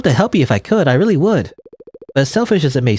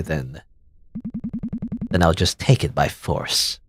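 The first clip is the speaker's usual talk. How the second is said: low menacing threat; 8 semitones lower, 7 dB quieter, slower than usual. Acted. Villain threatens to take by force.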